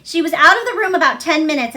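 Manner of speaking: usual speaking voice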